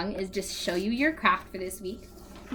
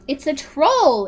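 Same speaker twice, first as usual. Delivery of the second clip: loud, alarmed cry of discovery; a boy spotting a troll, exclaiming loudly; excited exclaim; 5 semitones higher, 9 dB louder, slower than usual. Acted. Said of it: "exclaimed George".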